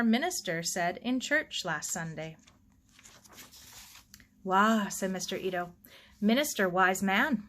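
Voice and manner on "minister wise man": ordinary delivery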